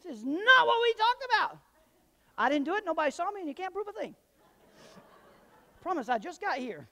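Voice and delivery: very high, loud mock panic; panicked self. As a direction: blurt it like a scared suspect. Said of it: "I'm like".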